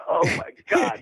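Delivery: high breathy near-tearful oh my God; retelling a rush of emotion, voice breaking up high; choked-up disbelief at getting the job; overwhelmed gasp. Retold or imitated. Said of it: "I'm like".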